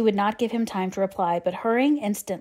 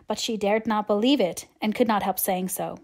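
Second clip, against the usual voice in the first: ordinary delivery, nothing marked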